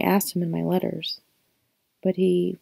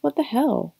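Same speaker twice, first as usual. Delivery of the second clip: high incredulous outburst; disbelieving, pitched up; surprised; 5 semitones higher than usual. A narrator's reading.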